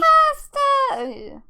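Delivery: high, loud drawn-out cheer; excited shout. Real, not acted. Player cheers the offer of lasagna, excited shout. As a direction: belt it out high and stretched.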